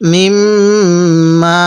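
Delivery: slow tajweed chant of মিম্মা; drawn-out Quranic recitation. Shown as a demonstration. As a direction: stretch মিম্মা very slowly, holding the nasal. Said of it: "এরকম করে পড়ব".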